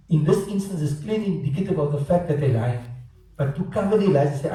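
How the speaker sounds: ordinary delivery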